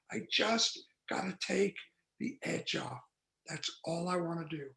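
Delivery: trailing off to a breathy hush; soft, needy, almost breathed; craving murmur. Retold or imitated. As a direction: let the craving fade out softly.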